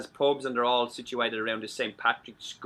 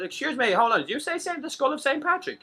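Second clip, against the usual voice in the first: astonished; 12 semitones higher than usual. Retold or imitated. American astonished in joke.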